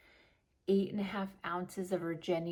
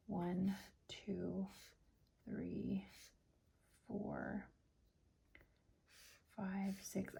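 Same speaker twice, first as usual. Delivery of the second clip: whispering; 11 dB quieter, much slower than usual. Real, not acted. Counting under breath.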